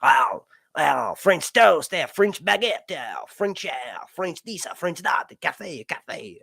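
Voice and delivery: hammed-up French accent; put-on French accent on food words; mock French accent. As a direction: play around with a silly French accent. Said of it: "let me get my French accent".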